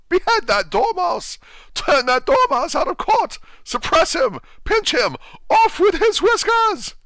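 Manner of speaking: high, loud furious shriek. Acted. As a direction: shriek it like a raging queen. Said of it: "the Queen shrieked out".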